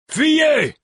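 very high, loud urgent shout; yell. A narrator's reading. Narrator warns the prince to flee, urgent yell. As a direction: yell a warning at full urgency. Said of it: "hurlai-je au prince".

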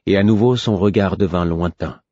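ordinary delivery

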